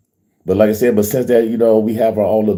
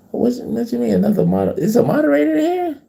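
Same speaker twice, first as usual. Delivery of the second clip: surprised high question. Real, not acted. Confused by a moderator's action.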